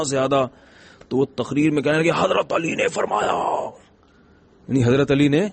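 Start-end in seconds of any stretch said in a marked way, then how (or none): 2.1-3.7 stretched-out, strained imitation of a screaming sermon; mock preacher's shouting. Imam yelling his sermon at a seated audience, mock shouting. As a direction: mimic an imam bellowing into a loudspeaker, drawing out the words. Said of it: "اتنی زور زور سے loud speaker پہ چیخ چیخ کے".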